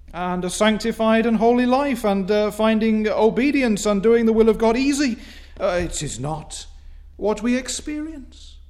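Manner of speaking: loud, high fervent preaching; preaching at full force. Acted. Preacher crying out over the congregation, loud and fervent. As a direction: build it to a ringing pulpit cry.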